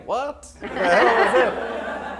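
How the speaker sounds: high, loud mock bewilderment, breaking into laughter; baffled outburst. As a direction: replay your shocked confusion, loud and laughing. Retells own baffled reaction.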